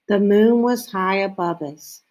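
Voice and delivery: ordinary delivery, nothing marked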